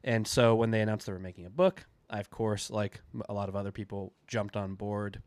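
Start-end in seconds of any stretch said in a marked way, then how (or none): none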